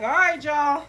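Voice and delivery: loud call to the crowd. Retold or imitated. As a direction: call out to everyone, firm. Calling out to viewers.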